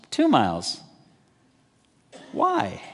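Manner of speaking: a baffled "Why?" leaping way up; incredulous disbelief. Retold or imitated. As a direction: sound floored by the request. Listener baffled by the command.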